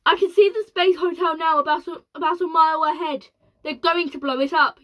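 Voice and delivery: loud, high yell. A narrator's reading. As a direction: yell in alarm. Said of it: "yelled ground control".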